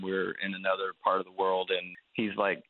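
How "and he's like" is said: ordinary delivery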